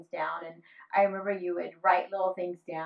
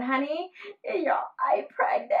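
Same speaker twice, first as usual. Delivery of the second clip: high, breathy whisper choked with mock tears; retold tearful whisper; imitating crying whisper; 10 semitones higher than usual. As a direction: whisper it like a daughter sobbing through the door. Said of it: "sobbing in the bathroom".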